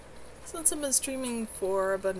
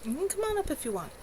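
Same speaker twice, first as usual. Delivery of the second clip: gentle pet coaxing; 9 semitones higher, faster than usual. Real, not acted.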